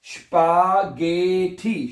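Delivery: स्पागेठी very slowly, गे stretched and lifted; sounding out. Shown as a demonstration. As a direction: teach स्पागेठी syllable by syllable. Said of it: "क्या बनेगा".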